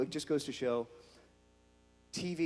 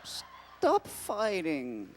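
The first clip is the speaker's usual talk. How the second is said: slow drawn-out stop fighting; slow plea; 2 semitones higher, much slower than usual. Acted. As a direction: plead slowly, dragging it out. Pleading with fighting characters.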